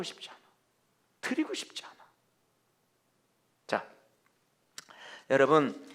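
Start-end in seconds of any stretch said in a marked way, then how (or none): 0.0-1.9 whispered. Acted. Voicing reluctant believers.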